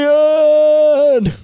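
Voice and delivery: high, loud, stretched-out cry; Rocky's yell. Acted. Rocky calling for his girl, a long loud yell. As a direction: bellow the name across the ring.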